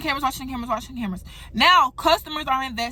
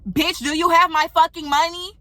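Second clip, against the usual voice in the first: raised, heated demand, a notch higher and louder; angry confrontation; 5 semitones higher, 5 dB louder than usual. Retold or imitated. Narrator confronting the girl who stole her money, heated. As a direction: fire the question at her like a threat.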